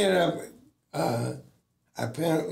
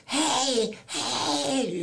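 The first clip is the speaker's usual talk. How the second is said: mock scream; 10 semitones higher than usual. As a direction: imitate her screaming at a held-back volume. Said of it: "just screaming".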